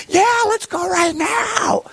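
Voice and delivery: put-on very high, slow shrieking child's cry; ecstatic kid shout. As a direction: scream it like an overjoyed six-year-old. Kids eager for heaven.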